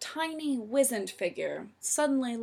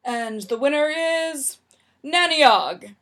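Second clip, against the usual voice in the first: loud, drawn-out announcer's proclamation; big slow build to a loud, strained name; slightly strangled proclamation; 3 semitones higher, 9 dB louder than usual. A narrator's reading. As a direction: announce the winner, stretched out and a touch strangled. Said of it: "proclaimed in a slightly strangled voice".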